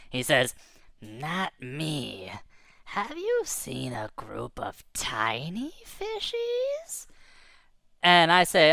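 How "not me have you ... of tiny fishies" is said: slow, softer menacing fish voice; slow, soft big-fish question. Acted. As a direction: drag it out like a lurking predator.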